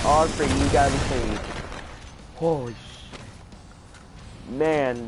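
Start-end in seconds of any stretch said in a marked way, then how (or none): 2.3-4.5 stunned whisper. Real, not acted. Shocked by gore.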